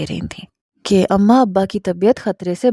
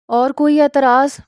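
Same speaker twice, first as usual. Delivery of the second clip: higher-pitched, restrained roar of a question; lion-like roar; 5 semitones higher than usual. A narrator's reading. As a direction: challenge him like a stern father. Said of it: "شیر کی طرح دھاڑ کے پوچھا". (شیر جیسی ہلکی دھاڑ)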